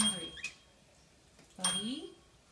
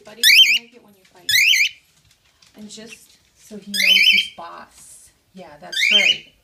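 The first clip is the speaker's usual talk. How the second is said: firm scolding; 19 dB louder, slower than usual. Real, not acted. Scolding dog over bird's noise.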